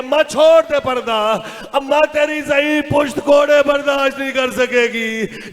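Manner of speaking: loud, wailing plea to a mother; impassioned imitation of weeping entreaty; tearful plea. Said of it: "रो के कहती है".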